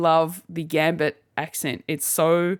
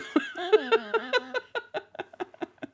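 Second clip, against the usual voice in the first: a much higher squealing laugh; 4 dB quieter than usual. Real, not acted. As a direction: break into a giddy squeal of laughter. Laughing mid-quote about the movie.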